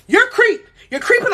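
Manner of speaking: mock excited. Excited mocking quote.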